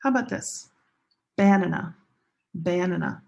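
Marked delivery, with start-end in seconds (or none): 1.4-3.2 "Banana" stressed on the first syllable, BAN-ana; wrong stress location. Shown as a demonstration. Teacher showing students a wrong word, "Banana" front-stressed as BAN-ana. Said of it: "Wrong stress location".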